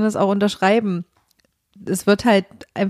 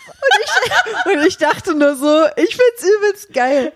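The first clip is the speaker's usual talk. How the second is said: laughing; 10 semitones higher, 4 dB louder, slower than usual. Real, not acted. Delighted reaction to friend's complaint.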